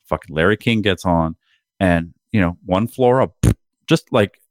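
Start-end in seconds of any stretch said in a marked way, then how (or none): none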